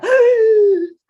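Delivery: loud, high startled squeal; sudden piercing storybook shriek; sharp squeal. Acted. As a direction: squeal as if frozen in midair. Scream freezes tiger in midair.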